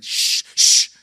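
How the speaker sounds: sharp unvoiced shh; shushing. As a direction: hush them firmly.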